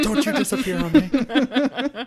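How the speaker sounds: high, loud, laughing parent voice; mock scolding parent. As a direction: scold playfully through laughter, drawing it out. Playing parent scolding vanished child.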